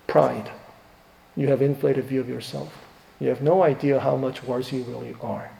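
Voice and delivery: usual speaking voice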